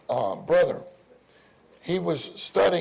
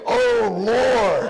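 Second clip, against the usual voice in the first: drawn-out, louder, higher plea; fervent cry of Oh Lord; 5 semitones higher, 8 dB louder than usual. Shown as a demonstration. Preacher showing the saints how to call, crying out.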